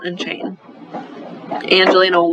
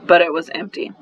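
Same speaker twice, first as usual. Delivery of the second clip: ordinary delivery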